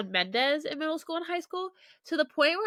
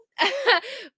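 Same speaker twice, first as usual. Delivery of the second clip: laugh; 12 semitones higher, 4 dB louder than usual. Real, not acted. Laughs at her own rambling.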